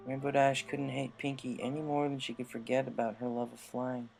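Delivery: usual speaking voice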